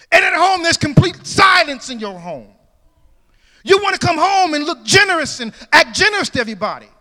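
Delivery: loud, high preaching cry; full-force preaching. Acted. Pastor exhorting his church, full-force preaching. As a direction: preach it out to the congregation.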